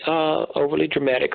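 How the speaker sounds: ordinary delivery, nothing marked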